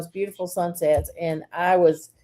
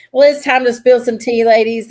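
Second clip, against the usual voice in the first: louder, upbeat segment announcer; 4 semitones higher, 8 dB louder than usual. Acted. Host announcing the gossip segment to the ladies, upbeat. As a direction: kick off the gossip segment with flair.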